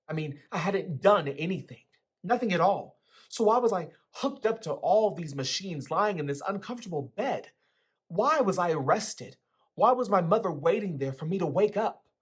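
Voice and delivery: agitated. A narrator's reading. Narrator's agitated confused thoughts.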